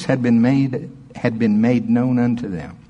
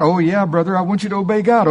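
put-on old lady; 7 semitones higher, faster than usual.

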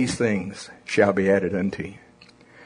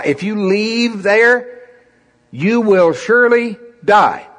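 urgent warning from headquarters; 8 semitones higher than usual. Retold or imitated. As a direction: plead with him not to leave the shuttle. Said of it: "They start going".

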